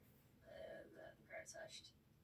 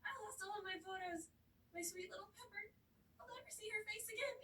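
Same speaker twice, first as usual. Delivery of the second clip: mock distraught citizen; 8 semitones higher, faster than usual.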